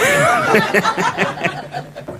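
real laugh. Real, not acted. Laughs at own joke.

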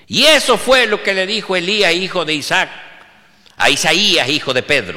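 high, loud country-priest sermon; mock-vehement pulpit delivery; vehement preaching. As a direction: thunder out the names like a preacher, each phrase falling. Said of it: "se pone vehemente".

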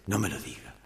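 low, soft voice from inside; a subdued, low-pitched plea; muted objection. Acted. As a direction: quietly protest from inside the booth. Joke's hidden voice, first objection.

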